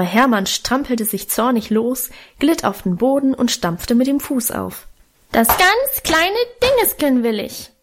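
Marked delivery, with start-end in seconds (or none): 5.3-7.7 put-on high wailing child voice; toddler tantrum. A narrator's reading. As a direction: wail it like a stamping toddler. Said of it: "heulte er".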